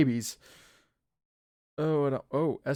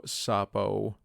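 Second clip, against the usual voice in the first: normal talking voice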